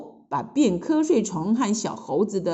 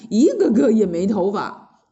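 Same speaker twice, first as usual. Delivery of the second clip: ordinary delivery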